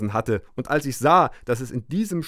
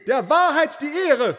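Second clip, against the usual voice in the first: shouted; 13 semitones higher, 3 dB louder than usual. A narrator's reading. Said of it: "schrie ich".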